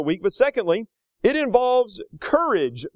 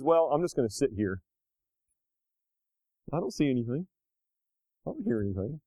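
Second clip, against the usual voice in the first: mock avoidant mumble; 4 semitones lower, 6 dB quieter than usual. Voicing people avoiding conflict.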